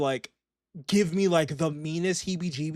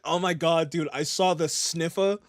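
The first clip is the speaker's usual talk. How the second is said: ordinary talk, nothing marked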